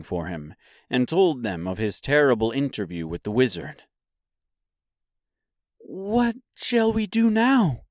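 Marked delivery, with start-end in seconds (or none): 5.8-7.8 put-on high, slow, forlorn girl's voice; little girl at a loss; sad Dorothy. A narrator's reading. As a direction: ask it sadly, pitched well up. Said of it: "asked Dorothy sadly".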